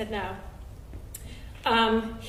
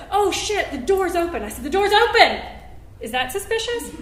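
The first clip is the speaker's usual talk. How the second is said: alarmed; 7 semitones higher, 6 dB louder than usual. Retold or imitated. Panicked realization on phone.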